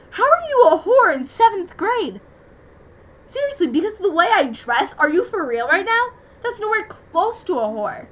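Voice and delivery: high, loud and outraged; indignant outburst. Real, not acted. Teen vlogger ranting at people who called her names, outraged. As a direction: fire it back in disbelief. Outraged rant about name-calling.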